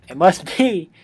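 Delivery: ordinary delivery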